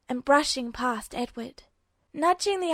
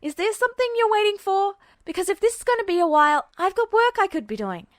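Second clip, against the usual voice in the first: higher, louder impatient demand that speeds up in the middle; annoyed; 7 semitones higher, 6 dB louder, faster than usual. Acted. Edward demanding to know what the Lance Corporal is waiting for, annoyed and loud. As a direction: push him, you've got better things to do. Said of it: "Edward's voice was annoyed".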